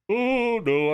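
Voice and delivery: high yawn-stretched oh no. Real, not acted. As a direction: say it mid-yawn. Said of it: "I'm yawning again".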